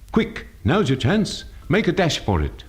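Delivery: high, urgent, short words; shortened words. Shown as a demonstration. Teacher contrasting with lengthened words, urgent shortened delivery. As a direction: urge them on with quick short words. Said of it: "In contrast".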